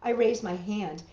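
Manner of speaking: plain reading voice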